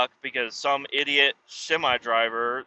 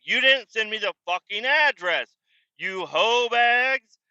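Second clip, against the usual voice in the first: angry yell; 8 semitones higher than usual. Retold or imitated. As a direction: drag out the insult at the end. Confronting coworkers about address.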